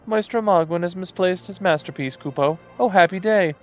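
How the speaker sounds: high, gleeful scheming moogle. Acted. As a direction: rejoice over a bargain. Greedy moogle rejoices.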